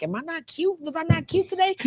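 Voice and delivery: high-pitched mock self-doubt. Artist questioning herself in the bathroom mirror, high mock-insecure voice.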